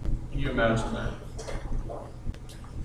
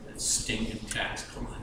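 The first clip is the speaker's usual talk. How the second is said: much softer, breathy contempt; disgusted mutter; 7 semitones lower, 15 dB quieter than usual. Acted. Crowd scorning the tax collector, muttered contempt. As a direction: mutter it under your breath.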